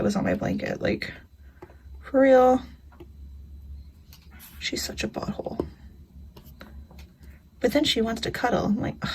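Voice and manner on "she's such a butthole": whispered, half-joking jab; whispered aside. Real, not acted. Grumbling about the cat in a whisper. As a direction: hush it like a secret insult.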